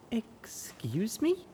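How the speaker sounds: soft, slow and uneasy; nervous. A narrator's reading. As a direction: ask warily, unsettled. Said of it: "Glory asked nervously".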